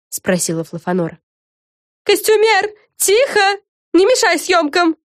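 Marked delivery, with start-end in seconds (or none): 2.0-5.0 high-pitched, bossy reprimand; scolding. A narrator's reading. Fren silences the costumer during filming, scolding. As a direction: shush her sharply in a high voice. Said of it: "прикрикнула на неё Френ".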